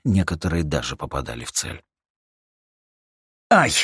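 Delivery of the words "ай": sharp yelp when hit; cry of pain. Acted. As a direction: yelp as a shot lands. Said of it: "крикнул Арти".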